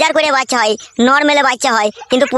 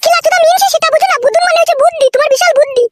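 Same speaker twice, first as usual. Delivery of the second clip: high-pitched, teasing woman's voice; a wife fondly mocking her husband's cleverness; wife's voice; 3 dB louder than usual. Wife teases husband about his brains, high-pitched and fond.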